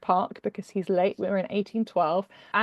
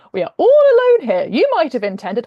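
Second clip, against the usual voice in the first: much higher and louder, pressing the point; excited insistence; 6 semitones higher, 10 dB louder than usual. Acted. Woman pressing William in the empty park, high and loud. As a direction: rise up and press the claim hard.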